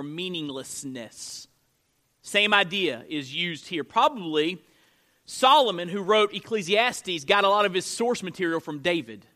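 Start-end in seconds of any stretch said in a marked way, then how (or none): none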